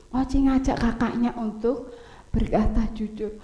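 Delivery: plain reading voice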